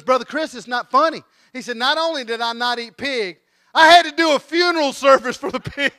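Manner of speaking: high, loud, hammed-up grievance; mock tearful protest. Upset Billy scolds his laughing pastor, high mock tearful protest. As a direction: complain loudly as the wounded party. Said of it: "Billy has got tears coming down".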